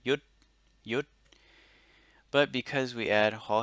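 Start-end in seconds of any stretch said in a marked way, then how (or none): 0.0-1.1 yut said twice with a Thai high tone. Shown as a demonstration. Teacher modelling yut on a high tone. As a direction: keep yut high and level. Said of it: "it would be a high tone".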